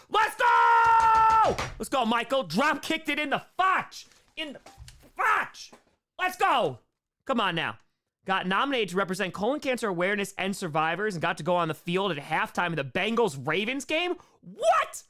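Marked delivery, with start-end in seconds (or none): 0.1-1.5 high reading bursting into a shout; elated roar at good news; building celebration. Real, not acted. Celebrates fan's cancer remission. 2.4-6.8 high, loud, punched-out celebration; pumped-up hype shouting; triumphant cheering. Real, not acted. Celebrates fan beating cancer. 14.4-15.0 very high, loud "what"; astonished outburst; amazed shout. Real, not acted. Amazed at fan's news.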